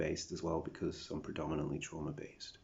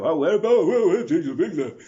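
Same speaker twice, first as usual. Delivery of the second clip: high, loud babbling mock questioner; mocking British people; 12 semitones higher, 18 dB louder than usual. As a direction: caricature their prying, much higher and louder. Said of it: "those fucking sorts of conversations with British people".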